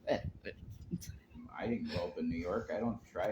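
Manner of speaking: slow, hushed whisper. Real, not acted. Denying doing the accent.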